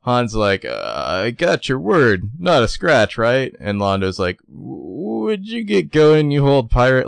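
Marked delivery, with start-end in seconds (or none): 1.2-3.5 high, loud checked-out Han line; bad acting. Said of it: "it's so terrible". 4.5-7.1 high, slow hammy Londo line; bad acting. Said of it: "it's so terrible".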